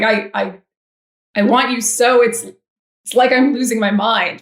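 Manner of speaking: stammer, then blurt it out; hesitant then blurted. Shown as a demonstration. Showing how we hesitate before blurting a thought out. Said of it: "I hesitate a little bit and I say my thought".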